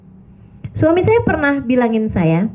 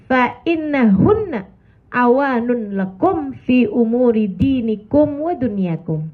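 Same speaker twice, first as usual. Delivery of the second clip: recited Arabic hadith; slower than usual. Acted.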